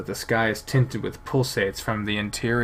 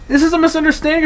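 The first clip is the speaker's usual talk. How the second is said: panicked pleading; 8 dB louder, much faster than usual. Acted. Panicked plea.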